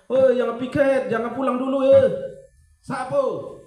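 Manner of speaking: high, loud yell of a teacher; mock teacher yell at students skipping cleaning duty. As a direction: shout at the class like an exasperated teacher. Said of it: "Miss Yuli harus teriak".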